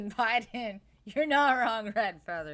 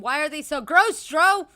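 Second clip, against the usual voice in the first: loud, higher "so gross"; loud disgusted outburst; 6 semitones higher, 13 dB louder than usual. Real, not acted. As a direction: yell it out in disgust. Exclaiming disgust at the donations.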